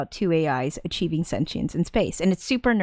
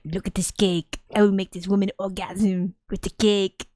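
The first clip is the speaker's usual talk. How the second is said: pompous mock-French delivery; hammed-up French accent; bad French accent. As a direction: play a pompous Frenchman, accent badly faked. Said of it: "my French accent is shit".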